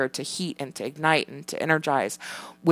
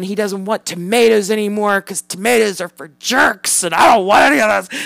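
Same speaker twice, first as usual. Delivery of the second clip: mock kid voice, raised and loud; imitating whiny son; 5 semitones higher, 8 dB louder than usual. Mother mimics her son refusing tomatoes, loud whiny kid voice. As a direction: play the fussy five-year-old shouting.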